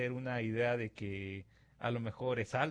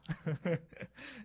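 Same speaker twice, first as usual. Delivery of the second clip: short laugh; 5 semitones higher than usual. Real, not acted. Laugh after the quote.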